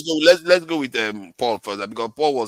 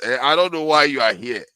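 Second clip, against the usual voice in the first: ordinary talk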